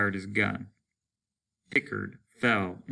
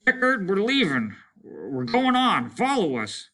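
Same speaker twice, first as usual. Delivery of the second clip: calling out; 10 semitones higher, 4 dB louder than usual. Acted. Calling to unresponsive man.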